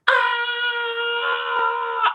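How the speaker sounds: long high-pitched scream; imitated scream. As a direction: demonstrate a drawn-out high scream.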